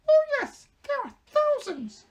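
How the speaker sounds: very high, very loud proud squeak; eager mouse queen. Acted. Mouse queen answering the Scarecrow, proud squeak. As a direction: boast of her subjects.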